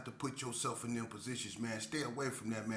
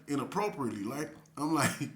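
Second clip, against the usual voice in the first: usual speaking voice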